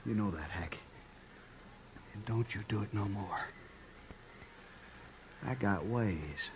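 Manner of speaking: low, soft, sullen threat; quiet menace. Acted. Alvy mutters a sullen threat at Hack, soft and low. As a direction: drop to a sulky murmur of warning.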